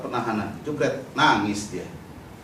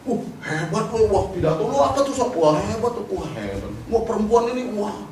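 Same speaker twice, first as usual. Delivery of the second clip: imitating officers, higher teasing praise; mock admiration; 5 semitones higher than usual. Police teasing the detained activist over his speech video, mock admiration. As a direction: gush in mock amazement.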